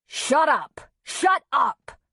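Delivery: high, loud angry bellow. A narrator's reading. Father bellowing at crying child, angry shout. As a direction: bellow it in short furious bursts. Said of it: "Griff bellowed at Callie".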